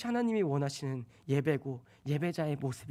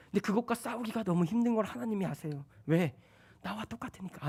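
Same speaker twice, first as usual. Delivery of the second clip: ordinary talk, nothing marked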